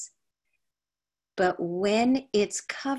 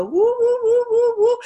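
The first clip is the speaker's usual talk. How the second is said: much higher and louder mock cooing; men cooing woo; 11 dB louder than usual. Men cooing woo at a baby, high and loud. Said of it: "it's so funny to hear men go".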